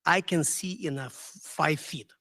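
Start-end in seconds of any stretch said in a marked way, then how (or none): none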